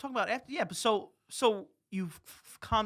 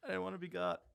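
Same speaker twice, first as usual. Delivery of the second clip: quiet echo; 11 dB quieter, faster than usual. Retold or imitated. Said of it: "Oh, that's so good".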